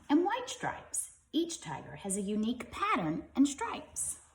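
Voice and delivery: lively, bright storybook reading; reading to children. Acted. Parent reading a tiger book to kids, lively storybook voice. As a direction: read it like a bedtime picture book.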